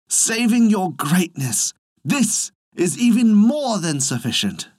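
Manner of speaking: high-pitched deferential plea; pleading peasant. A narrator's reading. Peasant begs thunder god to relent.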